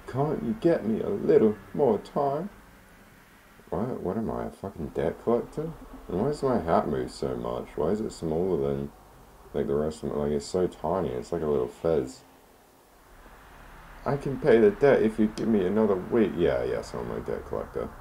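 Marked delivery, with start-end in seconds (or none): 0.1-2.5 put-on high old lady voice begging; pleading old lady. Landlady begging the debt collector for time, high-pitched. 14.0-16.3 put-on a somewhat higher pleading old woman; pleading old lady. Landlady promising to pay the debt, somewhat higher.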